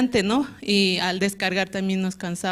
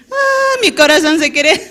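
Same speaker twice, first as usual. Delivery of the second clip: panicked outburst; 8 semitones higher, 8 dB louder than usual. Retold or imitated. Panic at first assignment.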